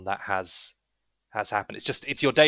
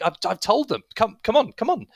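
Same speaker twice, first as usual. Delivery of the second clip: high, loud urging; harried; 11 semitones higher, 6 dB louder than usual. Acted. Young man hurries visitors in after nagging, harried. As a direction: hurry them along, exasperated and higher.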